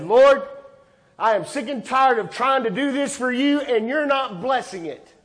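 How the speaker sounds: loud, high, fed-up outburst at the Lord; angry complaint to God. Retold or imitated. Pastor retelling his angry complaint to God, loud and fed up. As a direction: vent it like a frustrated man yelling at heaven. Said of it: "And I got mad at God".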